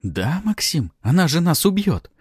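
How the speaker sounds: high, alarmed protest; indignant. A narrator's reading. Pafi warning the hero of death, indignant. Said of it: "негодовала Пафи".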